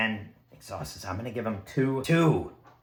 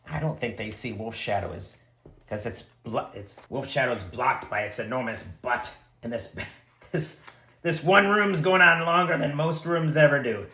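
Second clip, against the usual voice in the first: ordinary talk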